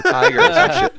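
high loud burst of laughter; laughing. Real, not acted. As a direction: laugh out the punchline. Laughing at own story.

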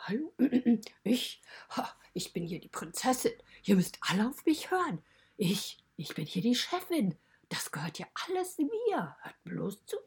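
bossy spoiled princess, lightly voiced; Paz mock princess. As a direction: play a spoiled princess giving orders, half in jest. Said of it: "Die sagt auch immer".

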